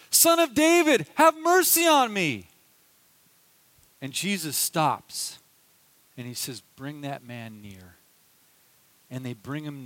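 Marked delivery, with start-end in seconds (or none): 0.1-2.5 much higher, louder desperate call; crying out louder. Retold or imitated. Beggar calling louder to Jesus over crowd, desperate shout. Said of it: "he cries out even louder". 6.7-7.9 soft, calm and low; gentle command. Retold or imitated. Jesus asking crowd to bring beggar near, gentle.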